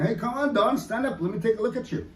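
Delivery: a hint of fussing aunties calling him up; lightly mimicked aunties urging Don to stand; teasing aunties. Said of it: "the aunties are going".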